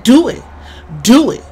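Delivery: loud, high-pitched mock dare; retold goading. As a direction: goad him in two punchy bursts. Retold dare before a fight.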